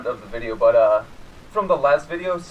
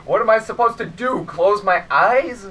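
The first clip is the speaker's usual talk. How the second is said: loud, high indignant retort; exasperated shout; 5 semitones higher, 7 dB louder, slower than usual. Retold or imitated. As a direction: snap back, incredulous and loud. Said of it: "And I'm like".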